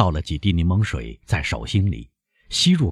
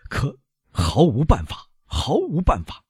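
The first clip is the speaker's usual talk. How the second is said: despairing; 8 semitones higher than usual. A narrator's reading. Doctor despairs over patient.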